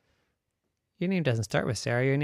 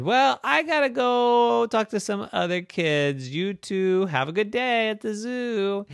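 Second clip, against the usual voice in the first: higher, friendly send-off; breezy goodbye to the kids; cheery zookeeper; 9 semitones higher, 5 dB louder than usual. Acted.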